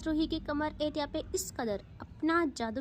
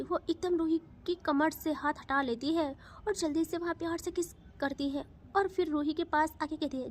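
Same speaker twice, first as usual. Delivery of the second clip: ordinary delivery, nothing marked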